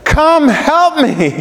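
very high, slow cry for help; pleading. Acted. Blind beggar pleading with Jesus to come help, crying out. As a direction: draw out a high, desperate plea. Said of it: "He's pleading with him".

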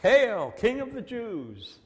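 loud, high-pitched mock salute; mocking acclaim. Soldiers kneeling before Jesus to mock him, loud jeering. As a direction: hail him in booming, exaggerated mockery. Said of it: "and mocked him".

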